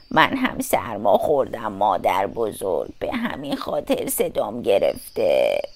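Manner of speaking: very low, quiet wolf voice; wicked wolf feigning cold. A narrator's reading. Wolf lies to grandmother about a cold, deep soft voice. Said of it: "گرگ بدجنس گفت".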